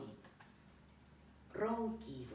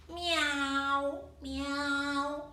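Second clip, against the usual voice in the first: high, loud, drawn-out meow; cat meowing; 8 semitones higher, 7 dB louder than usual. Acted. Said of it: "maulló". (maullido agudo y sostenido)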